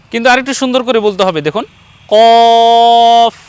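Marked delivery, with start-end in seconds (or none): 2.1-3.4 কফ heavy and loud, vowel held long before the f; thick deep qaf. Shown as a demonstration. Teacher shows a more beautiful way, thick long কফ. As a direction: stretch কফ with a thick back-of-throat q. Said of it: "আওয়াজটা একটু মোটা হবে".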